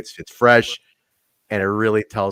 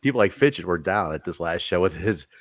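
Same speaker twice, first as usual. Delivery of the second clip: ordinary talk, nothing marked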